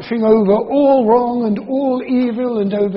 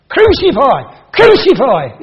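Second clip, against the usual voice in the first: louder, higher mock mob shout; crowd shout; 5 semitones higher, 8 dB louder, slower than usual. The mob shouting at Pilate, hammed-up loud shout. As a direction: give it the full impact of the mob. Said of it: "is what they shout".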